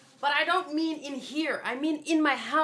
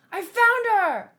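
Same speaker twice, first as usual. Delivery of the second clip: higher, louder, slow triumphant cry; excited shout; 9 semitones higher, 7 dB louder than usual. Acted. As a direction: announce it with joy. Character excited discovery.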